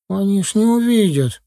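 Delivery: put-on high-pitched little-boy voice; doubtful boy. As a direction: answer back as a child, voice up. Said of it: "отозвался мальчишка". (мальчишка, высоким голосом)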